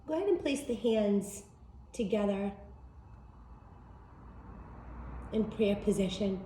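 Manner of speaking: soft, unhurried meditation guide voice; guided meditation. Acted.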